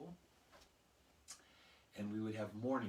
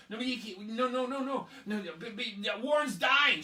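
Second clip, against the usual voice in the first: put-on higher, louder panicked kid yelling; frantic shouting; 11 semitones higher, 12 dB louder than usual. Classmates shouting at Emily that Warren is dying. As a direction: yell it like a friend is in trouble.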